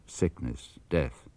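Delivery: plain reading voice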